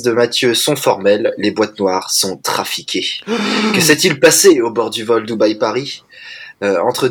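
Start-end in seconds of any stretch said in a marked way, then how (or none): 3.1-3.7 sharp gasp of shock; dramatic gasp. Acted. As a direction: gasp as if at a twist. Dramatic gasp in film summary.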